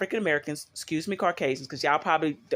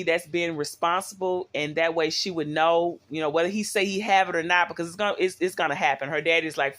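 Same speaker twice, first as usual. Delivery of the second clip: ordinary talk, nothing marked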